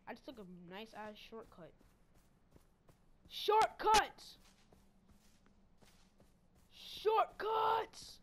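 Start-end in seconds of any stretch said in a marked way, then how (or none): 3.3-4.4 loud, higher cry of Shortcuts; excited shout. Real, not acted. Racing past the rival, excited shout. 6.7-8.2 loud, higher cry of Shortcuts; excited shout. Real, not acted. Racing past the rival, excited shout.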